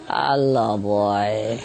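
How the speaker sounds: low, drawn-out greeting; imitated sleep-talking parrot; bird talking in dreams. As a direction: say it slowly, deep in pitch. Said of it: "they talk in a dream".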